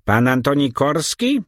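put-on high, rasping old man's question; croaky old baron. A narrator's reading. Sly old baron croaking a suspicious question. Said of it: "zapytał skrzeczącym głosem".

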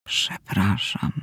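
very low, soft, slow whisper; faint whisper. A narrator's reading. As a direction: barely breathe the apology out. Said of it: "tak cicho". (ledwo słyszalny szept)

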